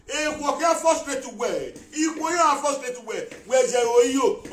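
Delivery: high, loud furious questions; angry shouting. Real, not acted. Ranting at Osagi who took money, furious shout. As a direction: demand it, shouting in anger.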